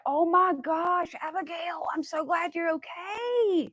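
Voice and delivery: high, slow cry of relief; shocked relief. Real, not acted. Hearing a viewer stepped on a copperhead, high shocked relief. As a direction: gasp it out as if a friend nearly got hurt.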